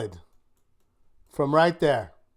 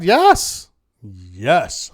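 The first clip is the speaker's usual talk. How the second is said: excited yes; 4 semitones lower, 5 dB louder than usual. Real, not acted. Celebrating alerts coming in.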